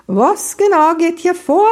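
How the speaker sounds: loud, high, commanding demand; forceful, high-pitched challenge; brisk, forceful voice. Acted. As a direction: ring out like someone taking charge. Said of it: "Eine forsche Stimme erscholl".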